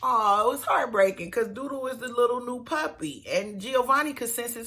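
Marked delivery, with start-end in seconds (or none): none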